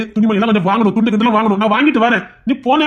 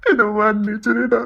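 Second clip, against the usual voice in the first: tearful remorse; 4 semitones higher than usual. Acted. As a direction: break down begging forgiveness. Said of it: "நீ அழாத".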